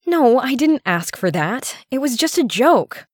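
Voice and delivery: high-pitched, flustered objection; indignant protest. Acted. Woman objects to her partner, indignant and high.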